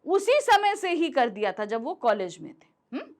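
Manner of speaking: ordinary talk, nothing marked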